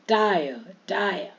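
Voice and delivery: "टायर" as taa-ya, no r; silent r. Shown as a demonstration. Teacher drilling dropped r, slow and deliberate. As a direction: slowly draw out "टायर", ending on open a. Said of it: "हायर नहीं बोलेंगे".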